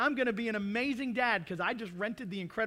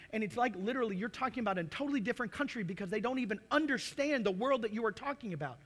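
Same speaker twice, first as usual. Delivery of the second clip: usual speaking voice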